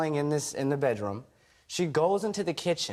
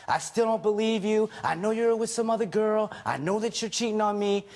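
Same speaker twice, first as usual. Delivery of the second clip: mild mock yell, pitched higher, imitating an accusing wife; a hint of a jealous wife yelling; retold yelling; 5 semitones higher than usual. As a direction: replay her accusations as a light angry outburst. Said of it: "yelling in my face".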